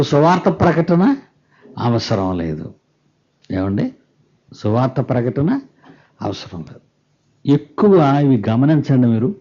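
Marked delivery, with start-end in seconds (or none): none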